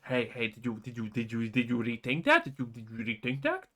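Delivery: low, wheedling mock voice; lightly voiced sneaky traitor. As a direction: needle him like a pushy schemer. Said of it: "he asks you again".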